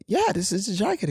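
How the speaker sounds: high put-on voice, bluffing that all is fine; mock-cheerful high reply. Reassuring client, acted quote.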